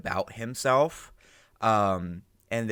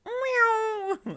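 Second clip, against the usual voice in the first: high, drawn-out meow-like swoop; imitated zoom noise. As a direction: make a long, high sound effect that drops at the end.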